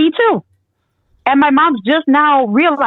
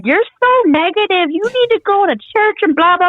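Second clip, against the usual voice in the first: mocking nagging voice; 8 semitones higher than usual. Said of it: "everybody's like".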